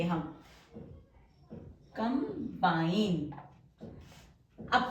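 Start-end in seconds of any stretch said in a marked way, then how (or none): 2.0-3.3 combine stretched slow and soft; drawn-out word. Shown as a demonstration. Teacher stretching combine slowly while writing the outline. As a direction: draw out combine syllable by syllable as you write.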